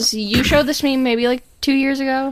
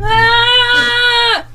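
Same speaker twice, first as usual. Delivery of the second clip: startled yelp; 12 semitones higher, 5 dB louder than usual. Real, not acted. Bumped the mic.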